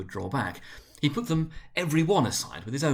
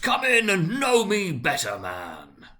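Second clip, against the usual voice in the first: big, loud, high hearty voice; a giant spirit beckoning with gusto; jolly giant ghost; 8 semitones higher, 5 dB louder, slower than usual. A narrator's reading. Ghost urging timid Scrooge closer, hearty and loud. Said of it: "exclaimed the ghost".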